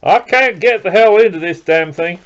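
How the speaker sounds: loud, high-pitched frustrated outburst; real exasperation. Real, not acted. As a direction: burst out, fed up with the packaging. Struggling to open a package.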